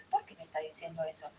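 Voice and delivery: quiet, quick, low question to oneself; unoffended self-questioning. Acted. Student reflecting on criticism instead of getting angry, quietly self-questioning. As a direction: wonder it softly, without anger. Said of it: "En vez de enojarse".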